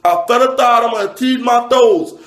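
high-pitched, put-on speech impediment; mocking impaired speech. Comic mimics who the shoes are for, mock speech impediment. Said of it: "people that talk like this".

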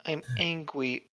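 put-on soft baby-talk pout; quiet, pretend grumpiness; cutesy mock anger. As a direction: say it small, like a sulky toddler. Baby-talk mockery of angry.

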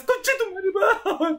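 slow, high curse through laughter; laughing curse. Real, not acted. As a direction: laugh as you swear. Laughing while dying.

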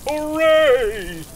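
high, loud hooray; big celebratory hippo shout; shouted cheer. A narrator's reading. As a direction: belt out a long cheer. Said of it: "Hippopotamus shouts".

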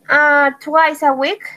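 ordinary talk, nothing marked

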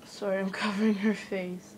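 ordinary delivery

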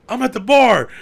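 high, loud brother on the phone; retold shout back. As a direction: holler it back over the phone.

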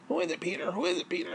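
imitating the neighbour nagging quietly; hushed nagging. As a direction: nag quietly down the line. Neighbour whispering on line.

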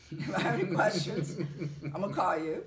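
loud, laughing while laying down the rules; half-joking ultimatum, laughing; laughing through it. Retold or imitated. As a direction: laugh your way through the demand. Retelling laying down the law.

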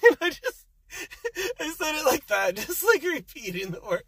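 high-pitched, words through laughter; laughing. Real, not acted. Driver mocking his own excuse, laughing as he talks. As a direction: laugh while getting the words out.

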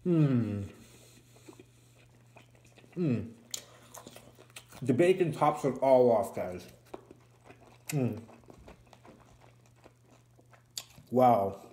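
talking while chewing burger; muffled through food-filled cheeks; mouth full, chewing. Real, not acted. Seen 1: Bites at -1.0, cheeks bulge and jaw chews through the words.

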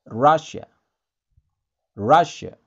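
Russia said as RAH-sha, loud and clear; English Russia. Shown as a demonstration. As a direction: Russia with a short a and sh.

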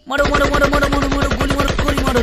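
loud, rapid cheering to shoot; excited shouting. Pretend. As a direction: egg them on, yell it. Urging the shot at snake. (जोश में चिल्लाकर)

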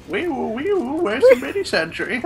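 high laughing siren wee woo; alarm shout. Pretend. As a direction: sing it like a siren, giggling.

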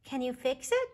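put-on high, small child's voice; little bear voice. A narrator's reading. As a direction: voice a curious little cub.

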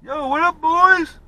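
loud, high call across the trail; shouted greeting. Real, not acted. Calling out to passing riders.

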